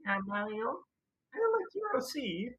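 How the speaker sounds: very high, slow cartoon Luigi; Luigi impression. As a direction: sing it out like a sweet Luigi. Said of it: "even I can do better Mario impressions".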